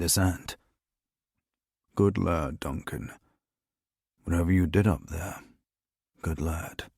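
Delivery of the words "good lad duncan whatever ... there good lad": low, soft murmur to oneself; quiet satisfaction. A narrator's reading. Boss alone once she was gone, quietly pleased. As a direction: murmur private praise with a hidden smile. Secret praise behind a hidden smile.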